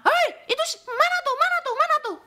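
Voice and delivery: high, loud calling for someone; imitated yelling. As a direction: yell out bossily as if hunting someone down. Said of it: "Diteriak-teriakin".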